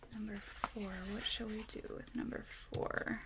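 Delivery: unvoiced whisper, thinking aloud; whispered to herself. Real, not acted. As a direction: mutter the question under your breath. Crafter muttering to herself.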